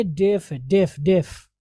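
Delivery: डेफ with a short "eh" vowel; correct pronunciation. Shown as a demonstration. Teacher drilling the corrected word for students, correct pronunciation. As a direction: say डेफ as "def", repeated. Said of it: "लेकिन ये क्या है".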